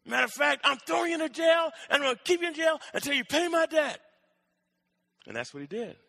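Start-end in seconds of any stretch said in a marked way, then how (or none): 0.0-4.0 high, heated sentencing; acted irate creditor; angry threat. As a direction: threaten the debtor in a raised pitch. Creditor threatens debtor with jail.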